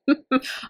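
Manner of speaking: short, high chuckle; laugh. Real, not acted. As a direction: chuckle at your own blank memory. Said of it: "I laugh 'cause I have to remember".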